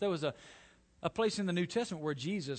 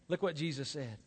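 ordinary delivery

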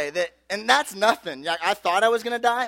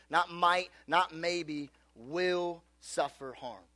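"will" set apart and drawn out slowly; slow, deliberate weight on "will", then soft "suffer harm"; stress on will; 5 dB quieter, much slower than usual. Real, not acted. Preacher ruling out maybe for teens, slow emphatic "will".

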